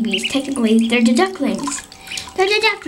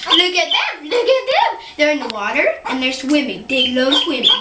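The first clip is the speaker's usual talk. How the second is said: ordinary talk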